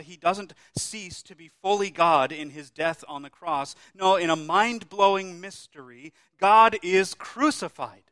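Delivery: loud, ringing pulpit proclamation; full-force preaching. Acted. Preacher to his congregation on the cross, booming proclamation. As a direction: preach it out, rising to the cry.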